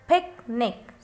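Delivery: "picnic" broken as "pic... nic"; split into syllables. Shown as a demonstration. Teacher showing there are two syllables, "picnic" split as "pic... nic". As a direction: say "picnic" slowly, pausing between "pic" and "nic". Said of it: "when we will pronounce it".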